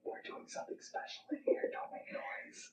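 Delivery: hushed whisper, barely any voice; a real whisper to someone nearby. Real, not acted. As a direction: whisper so nobody hears. Hushing others during a recording.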